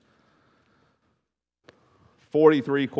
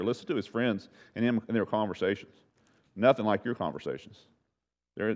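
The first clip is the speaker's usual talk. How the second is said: normal talking voice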